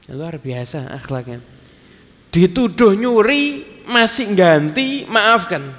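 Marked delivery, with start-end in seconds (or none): 2.3-5.7 raised high, slow, and weighted with awe; high, slow exclamation of wonder; rousing preacher exclamation. Acted. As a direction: hammer home the moral, slowly.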